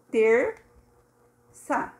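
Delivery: "terça" split in two, long pause between; syllable by syllable. Shown as a demonstration. Teacher counting syllables with objects, split "terça". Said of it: "um se refere a cada sílaba".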